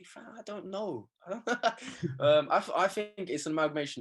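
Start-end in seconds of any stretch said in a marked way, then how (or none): 0.0-1.1 high, soft, drawn-out helplessness; retold bafflement. Player answering commenters about his accent, amused bafflement. 1.3-2.1 short high chuckle; amused laugh. Real, not acted. Player amused by questions about his accent, chuckling.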